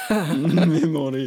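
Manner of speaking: laughing mock Croatian accent, drawn out; slow, amused Croatian-accent imitation. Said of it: "Croatian accent just for a laugh".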